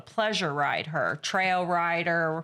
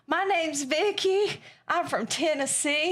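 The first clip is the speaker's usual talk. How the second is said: put-on Southern accent; 8 semitones higher than usual. Quoting a Tennessee friend to an Italian guard, in a Southern accent. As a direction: put on an excited Tennessee twang. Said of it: "she gets all excited and she's like".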